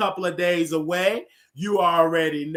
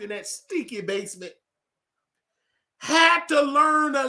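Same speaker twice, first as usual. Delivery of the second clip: preaching; 8 semitones higher, 4 dB louder than usual. Acted. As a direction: preach it from the pulpit. Sermon-like rant about Oliver.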